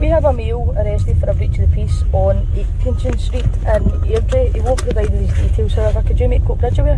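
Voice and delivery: clipped, steady radio procedure voice; officer calling control over the radio; police radio call. Real, not acted.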